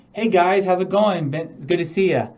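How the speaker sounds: bright, loud, upbeat hello; open, friendly greeting. Shown as a demonstration. Coach showing a nervous client an open, welcoming greeting. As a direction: greet them like old friends, high and loud. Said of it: "you open your arms and say".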